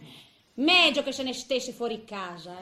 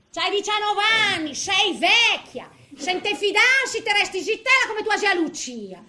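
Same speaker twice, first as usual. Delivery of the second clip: madre che rimprovera; 6 semitones higher, 6 dB louder than usual. Retold or imitated.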